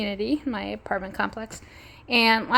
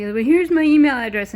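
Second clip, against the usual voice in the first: mock yell; 7 semitones higher, 9 dB louder than usual. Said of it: "one of them was yelling".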